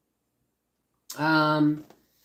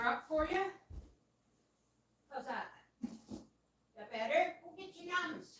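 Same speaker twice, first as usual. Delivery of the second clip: pet talk; 9 semitones higher, 7 dB quieter, faster than usual. Real, not acted. Feeding the pet chicken.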